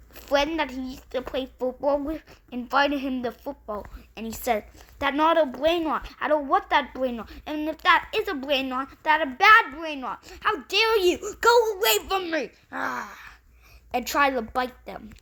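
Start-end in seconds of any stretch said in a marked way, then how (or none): 5.0-13.2 louder, rising into a higher crying yell; a tantrum that boils over; angry meltdown. Acted. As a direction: kid screaming at his friend to go away. Boy rejects his friend's invitation.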